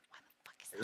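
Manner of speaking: whispered, under the breath; hushed aside. Real, not acted. Player whispering in disbelief at the card.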